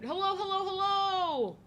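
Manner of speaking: high, loud sing-song hello; high cheery hello-hello-hello; singsong greeting. Real, not acted. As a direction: call out a bright stream welcome. Greeting stream viewers again.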